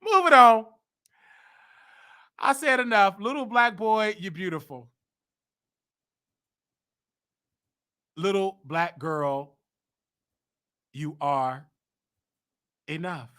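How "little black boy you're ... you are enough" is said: tender affirmation. Real, not acted. Affirmation to children.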